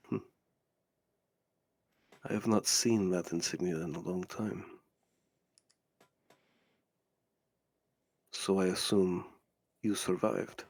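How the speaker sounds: low, soft, deliberate musing; quiet villain recalling something old; menacing stranger, recognising. Acted. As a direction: speak low with long, weighty pauses. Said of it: "And he just goes".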